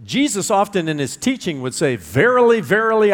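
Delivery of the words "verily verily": Verily, verily pitched high and loud, each one punched; emphatic repetition. Shown as a demonstration. Preacher voicing Jesus stressing his words, high and loud. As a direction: ring out Verily, verily with rising emphasis. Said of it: "as a way of emphasizing".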